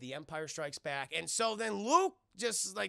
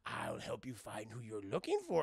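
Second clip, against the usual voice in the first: low, softer goblin voice; little goblin man; 9 semitones lower, 4 dB quieter than usual. Retold or imitated. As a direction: offer help as a mysterious little creature. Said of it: "the little goblin man's like".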